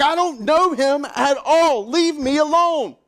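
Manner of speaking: loud, high, drawn-out shout; angry outburst. Acted. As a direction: shout them off in an acted rage. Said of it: "He finally gets so angry, he curses at him".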